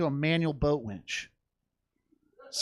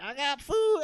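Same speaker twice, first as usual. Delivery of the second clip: high granny voice; lightly voiced old lady offering food; grandma voice; 12 semitones higher, slower than usual. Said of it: "she's like".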